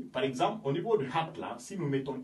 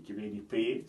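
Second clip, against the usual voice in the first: ordinary delivery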